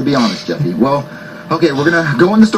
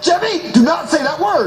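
high-pitched stern order; exasperated scolding; 7 semitones higher than usual. Acted. Father forbidding his son a swear word, exasperated. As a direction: forbid it, patience gone.

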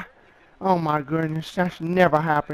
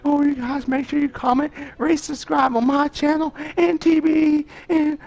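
giddy sign-off after the win; excited; 7 semitones higher than usual. Real, not acted. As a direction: still buzzing from the win. Still celebrating, signing off.

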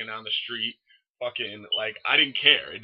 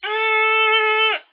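long, loud, very high squeal; grinning squeal; 12 dB louder than usual. Retold or imitated. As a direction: hold a piercing squeal through a huge grin. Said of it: "grinning, like".

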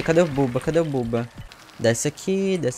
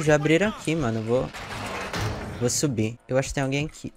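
ordinary talk

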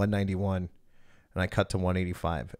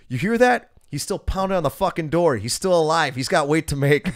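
higher and louder, gleeful taunting; gloating friend; 8 semitones higher, 8 dB louder than usual. Retold or imitated. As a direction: crow about it to the people outside. Friend gloats outside sauna door.

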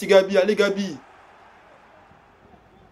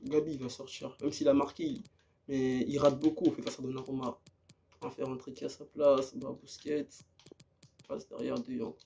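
much softer, low murmur while making subs; quiet aside during substitutions; muttering to himself; 10 semitones lower, 14 dB quieter than usual. Real, not acted. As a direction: talk under his breath to himself. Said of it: "quiet aside managing team".

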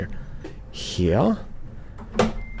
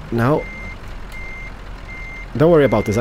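ordinary delivery

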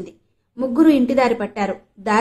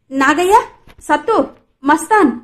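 loud, higher calls of names across the well; shouting for help; 6 semitones higher, 7 dB louder than usual. A narrator's reading. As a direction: yell the names in panic to bring people running. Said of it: "అరవసాగాడు". (భయంతో గట్టిగా పేర్లు అరవడం)

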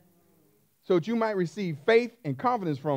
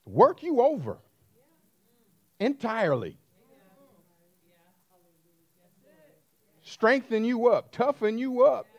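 higher and louder, building fervour; rising preaching; 4 semitones higher, 4 dB louder than usual. Acted. Preacher urging the congregation to let endurance work. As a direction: drive it home like a pulpit climax.

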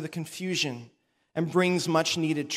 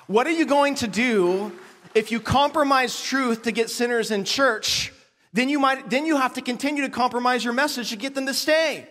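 impassioned preaching; 5 semitones higher than usual. Acted. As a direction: drive the point home from the pulpit. Preaching at full force.

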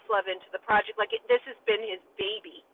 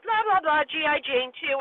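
usual speaking voice

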